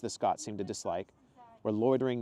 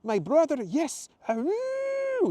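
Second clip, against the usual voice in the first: loud, very high Italian whoop; jubilant howl; 8 dB louder, much slower than usual. Acted. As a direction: howl it skyward with joy. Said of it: "he howled into the morning sky".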